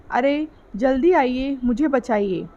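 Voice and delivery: ordinary delivery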